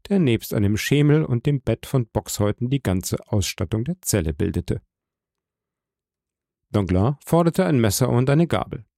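usual speaking voice

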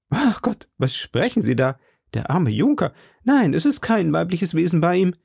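high, swooping landlady exclamation; high-voiced hostess defending her guest; innkeeper woman, protesting. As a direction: protest as the scandalised hostess, voice jumping up.

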